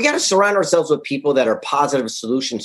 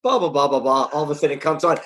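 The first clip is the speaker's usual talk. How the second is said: usual speaking voice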